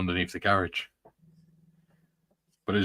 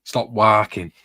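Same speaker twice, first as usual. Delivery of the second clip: mum's saying; 7 dB louder, slower than usual. Retold or imitated. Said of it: "my mum used to say".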